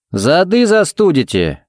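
mildly stern sheriff's warning. A narrator's reading. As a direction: chide them with drawn-out words. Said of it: "сказал им шериф строго".

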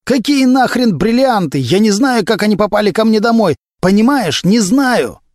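high, frantic, exasperated denial; howling protest. A narrator's reading. Suspect denying he knows about the diamonds, howling. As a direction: wail your denial, pitch held high throughout. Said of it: "взвыл Глущенко".

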